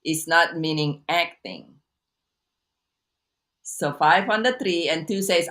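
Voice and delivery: usual speaking voice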